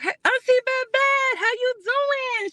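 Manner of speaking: high, slow, drawn-out hello to a viewer; sing-song greeting. Real, not acted.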